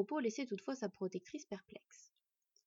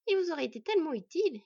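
higher, loud sarcastic jab; ironic; 7 semitones higher, 8 dB louder, faster than usual. Acted. Wife mocking her husband's usefulness, ironically. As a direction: tease with mock admiration. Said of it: "ironisa sa femme".

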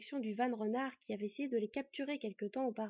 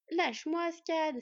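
loud, high sharp order; exclaiming; 7 semitones higher, 6 dB louder, slower than usual. A narrator's reading. Borde ordering Scad off his ankle, loud exclamation. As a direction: cry out at being bitten. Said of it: "s'exclama Borde".

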